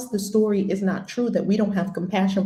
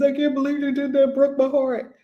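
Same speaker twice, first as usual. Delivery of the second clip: higher, whiny mock lament; mock heartbreak; 7 semitones higher than usual. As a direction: play the heartbroken one, hammed up. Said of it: "You're gonna have the sadness".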